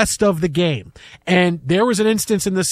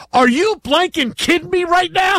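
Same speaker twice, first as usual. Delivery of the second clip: shouting; 12 semitones higher than usual. Retold or imitated. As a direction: yell it in disbelief. Retold wife shouting at him.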